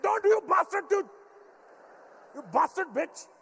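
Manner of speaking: high loud furious Indian-dad yell. Retold or imitated. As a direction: lose it completely at the cheeky son. Dad voiced, exploding at son.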